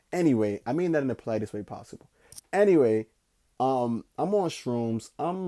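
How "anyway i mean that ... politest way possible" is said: ordinary delivery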